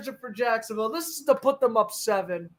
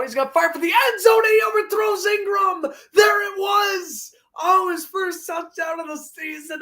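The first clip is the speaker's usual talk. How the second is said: excited shouting; 6 semitones higher than usual. Real, not acted. Touchdown pass play-by-play.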